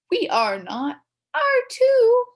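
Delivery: loud, high back-and-forth; two kids arguing loudly; childish bickering. Acted. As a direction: squabble like kids.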